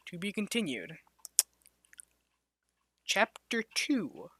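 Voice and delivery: ordinary delivery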